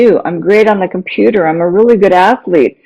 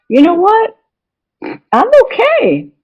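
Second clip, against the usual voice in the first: high voiced inner thought. Inner voice of self-worth.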